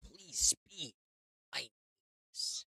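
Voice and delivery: quiet, hushed begging plea; barely voiced, small plea; soft pleading. Repeated plea, soft take.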